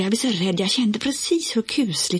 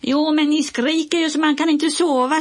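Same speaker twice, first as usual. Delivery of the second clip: annoyed shout; 11 semitones higher, 4 dB louder than usual. A narrator's reading. Olle yelling back annoyed.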